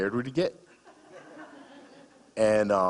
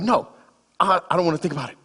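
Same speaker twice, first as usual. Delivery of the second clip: stubborn refusal; 10 semitones higher, 7 dB louder, much faster than usual. Retold or imitated. Ex-wife refusing again.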